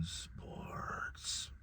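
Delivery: whispered, barely audible word; mock whisper. Real, not acted. Host whispering Sports to listeners, mock reverent. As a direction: breathe the word like a secret. Mock awe at TV-free pizza place.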